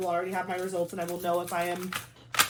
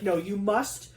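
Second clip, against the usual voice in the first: bossy body voice; 2 semitones higher, 6 dB louder than usual. Retold or imitated. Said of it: "it was like".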